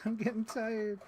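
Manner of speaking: laughing, high, tired whine; giggling tired repeat. Real, not acted. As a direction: laugh it out, worn out.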